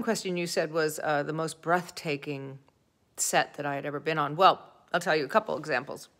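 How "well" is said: ordinary talk, nothing marked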